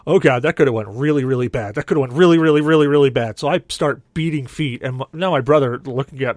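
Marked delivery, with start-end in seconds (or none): 0.0-3.4 louder, mock-panicked inner thought; retold alarm. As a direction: build the dread through each "really". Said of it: "I'm thinking to myself".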